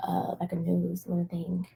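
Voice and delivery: breathy whispered aside. Real, not acted. Host joking about the glitchy video, in a whisper. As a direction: whisper it off to the side.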